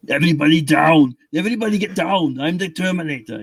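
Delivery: lightly hammed-up Austrian action-hero voice; mock Terminator. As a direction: bark it like a movie cyborg, half joking. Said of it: "I could do all kinds of".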